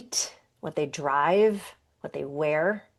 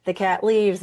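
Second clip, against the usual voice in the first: usual speaking voice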